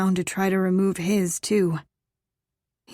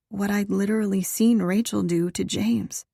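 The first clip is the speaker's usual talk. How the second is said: plain reading voice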